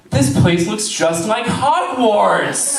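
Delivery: high, slow starry-eyed gasp; mock awed gushing. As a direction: gush like a wide-eyed tourist. Mocking awed students.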